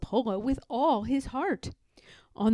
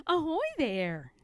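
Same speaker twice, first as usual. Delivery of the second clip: calling out; 7 semitones higher than usual. Acted. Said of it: "he called to the raccoons".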